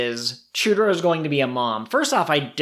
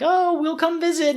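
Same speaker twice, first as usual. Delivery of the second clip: much higher, sing-song mock parent voice; mock owners. Host retelling the owners' goodbye in a high mock voice. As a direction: chirp it like a cartoon grown-up. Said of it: "the humans are saying, like".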